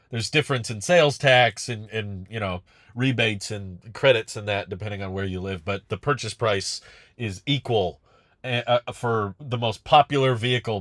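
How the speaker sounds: usual speaking voice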